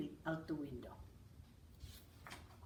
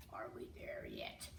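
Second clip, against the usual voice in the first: low breathy growl, much lower; 10 dB quieter than usual. Acted. Bear-suited little brother complains, low unvoiced growl. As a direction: moan it like a tired bear cub. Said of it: "growled Uli for the third time".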